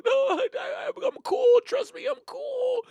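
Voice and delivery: high strained whiny voice; high cracking kid voice; embarrassed teen. Retold or imitated. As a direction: insist you're fine while cracking.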